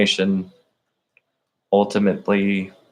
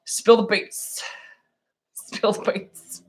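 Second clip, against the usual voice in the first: put-on voice; 12 semitones higher, 3 dB quieter, slower than usual. Soundbite catchphrase in put-on voice.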